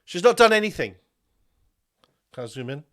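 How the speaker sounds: usual speaking voice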